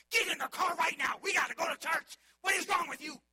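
loud, high furious yell; angry parent yelling. Retold or imitated. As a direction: bark at the kids like a frazzled parent. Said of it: "They're not like".

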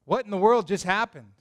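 usual speaking voice

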